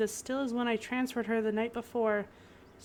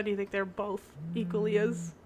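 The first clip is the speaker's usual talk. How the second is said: ordinary talk, nothing marked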